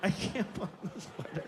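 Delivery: mock tearful helplessness; hammed-up teary defeat; smiling through tears. Dad failing to button toddler's sweater, mock tearful. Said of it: "smiling with tears".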